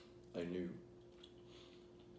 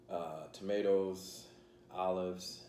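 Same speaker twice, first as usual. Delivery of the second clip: normal talking voice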